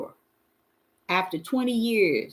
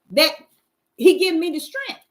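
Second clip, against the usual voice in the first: ordinary talk, nothing marked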